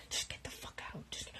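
urgent whisper. Acted. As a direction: whisper him out of the room. Hissing him out of the room.

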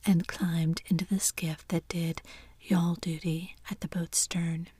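usual speaking voice

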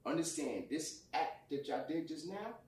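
cold and quiet, every word weighed; calm, collected. Retold or imitated. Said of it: "I'm gonna talk calm".

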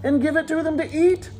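exaggerated sarcastic disbelief. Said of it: "You hear the sarcasm?".

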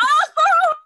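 very high, loud drawn-out "oh"; re-enacted wail of shock; shocked cry. Designer hearing Megan ask about the magazine, shocked cry.

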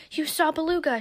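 high, loud exclamation; excited cry. A narrator's reading. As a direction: burst out in excited amazement. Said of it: "she cried".

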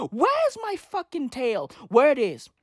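ordinary talk

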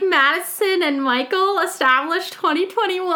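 high gushing read of the sign. Retold or imitated. Quoting her boyfriend's sign, high gushing voice. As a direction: read it out like it melts you. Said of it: "And it says, like".

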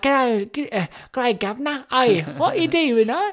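put-on high, loud caricature Cockney-style British accent; awful British accent. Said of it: "Accents were awful".

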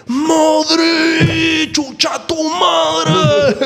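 high, slow, hammed-up stadium yell; rowdy fan shout. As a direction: bellow it like a rowdy fan cursing at the screen. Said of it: "Tú nunca habías visto un chacal así".

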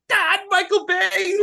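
high, slow mock wail; hammed-up moved viewer; mock swooning. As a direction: gush it slowly in a high voice. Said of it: "I'm just like".

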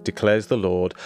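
ordinary talk, nothing marked

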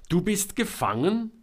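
high, loud doubting question; incredulous question. A narrator's reading. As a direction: question it with open disbelief. Selma doubts Georg's claim. (laut und ungläubig nachfragen)

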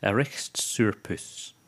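"Erichtsurpus" said slowly in broad Scots; slow Scots "Erichtsurpus", with a throaty ch; Scottish phrase. Shown as a demonstration. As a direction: present the Scots phrase "Erichtsurpus", each part drawn out. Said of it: "Scottish word of the week".

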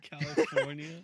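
loud, high word through laughter; laughing correction. Real, not acted. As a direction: blurt it out while cracking up. Laughing as they correct the co-host.

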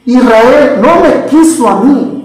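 slow, drawn-out phrases with pauses between; emphatic scripture repetition. Shown as a demonstration. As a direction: let each phrase of the verse land slowly. Repeats God's hard words for weight. (repetición lenta y solemne del versículo)

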